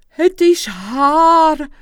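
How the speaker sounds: loud, high, drawn-out cry of recognition; big stretched exclamation in character. A narrator's reading. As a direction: call it out slowly, overcome. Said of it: "riep hij".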